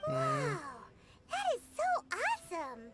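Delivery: high, bright-eyed wonder; amazed. Acted. Child marveling at the firework recipe slips, excited. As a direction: gush with wide-eyed delight. Kid amazed by family tradition.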